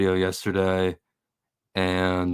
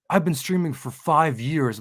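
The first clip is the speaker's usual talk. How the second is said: mock boast in a higher voice; imitated bragging; 6 semitones higher than usual. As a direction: mimic a streamer showing off. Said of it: "he's bragging, and he's like".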